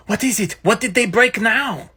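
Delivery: usual speaking voice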